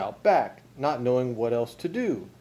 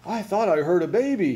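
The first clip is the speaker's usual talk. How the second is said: higher, louder startled outcry; crying out; 7 semitones higher, 5 dB louder than usual. A narrator's reading. Mister Frizzle rushes downstairs, loud high outcry. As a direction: cry it out in alarm. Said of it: "he cried".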